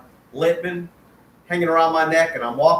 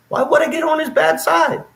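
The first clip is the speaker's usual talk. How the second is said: incredulous question; 11 semitones higher than usual. Retold or imitated. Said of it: "And I'm like".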